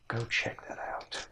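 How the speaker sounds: hushed, breathy command; captain quietly sending the Olog to check; whispered order. Acted. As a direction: whisper the order so the camp won't hear.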